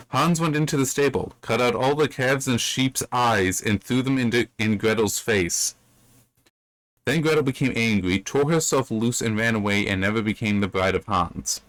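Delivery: telling a fairy tale aloud; reading a Grimm tale to listeners; story narration. A narrator's reading.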